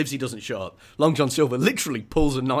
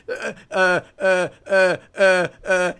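high, loud nervous stammer; 7 semitones higher, 7 dB louder, slower than usual. Retold or imitated. Jim stammering as he sells out Bill, nervous stammer. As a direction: blurt out uh uh in panic. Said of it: "He just goes".

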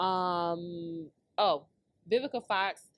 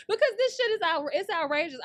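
higher and louder, still laughing; laughing outrage; 9 semitones higher, 4 dB louder than usual. Real, not acted. Telling fellas it's outrageous, still laughing and loud. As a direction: push through the laugh, exasperated.

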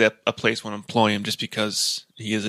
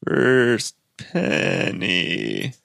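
slow drawn-out; much slower than usual. Pretend. Drawn out announcement.

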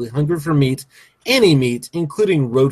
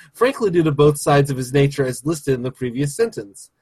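ordinary talk, nothing marked

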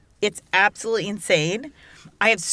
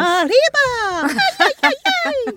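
festive cheer; 5 dB louder than usual. Acted. Mexican cheer over margaritas.

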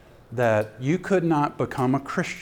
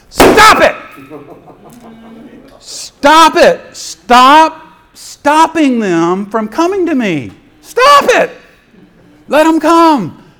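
very loud, high-pitched angry shouting; indignant, ticked; 13 semitones higher, 13 dB louder, much slower than usual. Acted. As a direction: explode at them, drawing out the commands. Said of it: "he was indignant. No, he's like".

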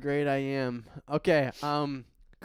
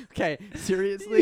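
laughing; 7 semitones higher than usual. Real, not acted. Laughing after pun.

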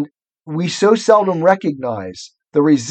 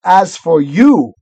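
slow, loud, drawn-out threat; menacing witch; 4 semitones higher, 8 dB louder, slower than usual. A narrator's reading. Witch turning on Edmund, menacing. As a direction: turn on the culprit, leaning hard on YOU.

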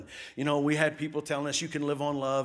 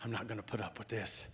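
mock muttering to yourself; 7 semitones lower, 12 dB quieter than usual. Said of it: "And you're like".

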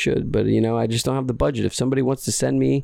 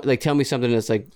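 normal talking voice

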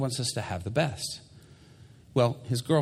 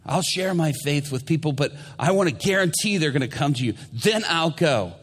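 loud, higher-pitched mock bargaining; insistent bargaining; 6 semitones higher, 5 dB louder than usual. Believer haggling with God for a guarantee, insistent. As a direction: push it like a demanding believer.

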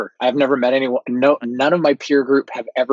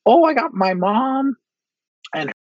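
high mimicking voice; 12 semitones higher than usual. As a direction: squeak it out like a clueless colleague. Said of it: "they're like".

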